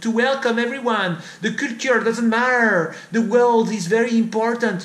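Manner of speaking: mock his cheerful slogans; mocking naive liberal. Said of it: "and he say".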